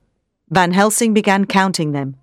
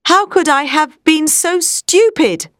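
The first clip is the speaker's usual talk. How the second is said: high, slow self-reproach; exclaiming; 8 semitones higher, slower than usual. A narrator's reading. Doctor realises what he overlooked, exclaiming. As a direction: exclaim it, kicking yourself, words stretched. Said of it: "he exclaimed".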